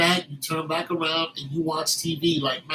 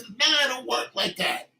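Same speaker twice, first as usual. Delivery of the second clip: ordinary talk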